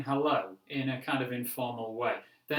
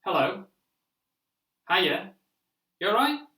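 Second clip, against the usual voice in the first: higher and louder, rising friendly hellos; casual British greetings, higher and louder; 6 semitones higher, 7 dB louder than usual. Shown as a demonstration. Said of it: "So remember".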